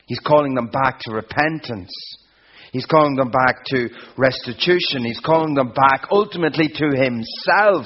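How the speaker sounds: rising sermon crescendo; building preaching. Acted. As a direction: drive the three lines up to a peak.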